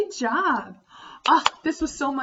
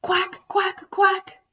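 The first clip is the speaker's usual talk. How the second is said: high, loud quacks; playful quack for kids; duck quacking; 10 semitones higher, 5 dB louder, much slower than usual. Shown as a demonstration. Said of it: "and says".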